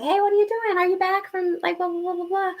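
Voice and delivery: very high, singsong fake-casual; breezy texting voice. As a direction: act out an innocent little text. Imitating her eager text message.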